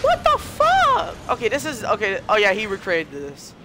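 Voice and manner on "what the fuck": drawn-out, high squeal of disbelief; slow high-pitched what the fuck; stunned disbelief. Real, not acted. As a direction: let it stretch out in shock. Shocked at a replica map.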